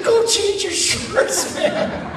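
very high, drawn-out squeal, laughing; mock disgusted order. Camp mate orders Dusty away, high laughing disgust. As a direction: squeal it slowly, breaking into laughter.

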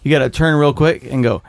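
ordinary talk, nothing marked

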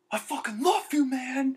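put-on very high, loud drunken holler; imitated drunk guys. Drunk friends in a video message, gushing high holler. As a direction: holler affection like a plastered buddy. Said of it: "these two drunk guys going".

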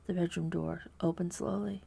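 usual speaking voice

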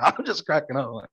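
usual speaking voice

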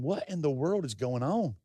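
hushed, low-pitched puzzlement; bewildered inner thought. Retold or imitated. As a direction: think it softly to yourself, baffled. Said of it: "as a seven-year-old boy thinking".

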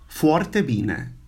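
normal talking voice

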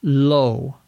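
low repeated alone, long oh glide; isolated model word. Shown as a demonstration. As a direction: say low on its own for learners. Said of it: "is pronounced low".

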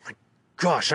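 plain reading voice

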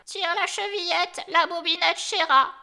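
high, loud grandmother's call; crying out. A narrator's reading. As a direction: call out from the sickbed to the door. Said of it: "lui cria".

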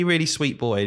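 ordinary talk, nothing marked

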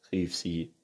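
usual speaking voice

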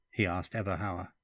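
usual speaking voice

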